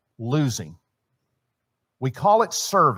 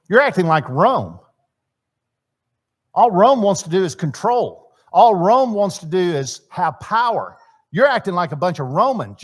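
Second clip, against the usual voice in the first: rebuking Jesus; 4 semitones higher, 6 dB louder than usual. Acted. Jesus rebuking disciples, voiced.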